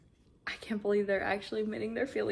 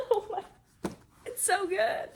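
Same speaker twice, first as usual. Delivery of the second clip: higher, laughing; giddy gush; 10 semitones higher, slower than usual. Real, not acted. Reader loving the chapter, giddy. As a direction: gush with delight.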